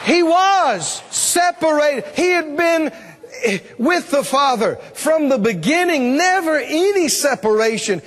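building to high-pitched, drawn-out preaching; impassioned preaching. Real, not acted. Preacher assuring congregation they will never be separated, impassioned preaching. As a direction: preach it with mounting force, stretching key words.

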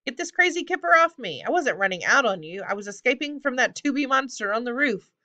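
high-pitched indignant goblin yelling; excitable, high complaint; yelled protest. A narrator's reading. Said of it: "Hogswheel yelled".

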